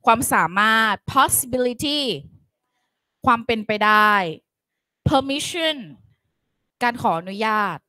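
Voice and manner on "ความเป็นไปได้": ordinary talk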